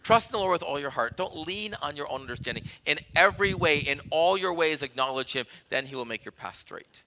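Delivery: normal talking voice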